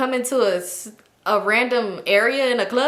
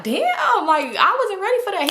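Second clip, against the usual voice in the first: high strained voice; choking friend; 9 semitones higher than usual. Retold or imitated. Friend caught off guard by the smoke, high and strained. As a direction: squeak it out like you just coughed.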